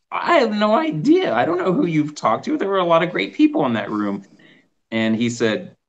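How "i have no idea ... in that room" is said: excited. Retold or imitated. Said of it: "I'm like".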